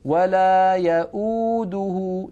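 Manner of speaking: يؤوده with the uu held long and slow; Quran recitation. Shown as a demonstration. Teacher reciting Ayat al-Kursi word slowly. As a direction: chant يؤوده stretching the madd. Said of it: "هذا مد بدل بمقدار حركتين".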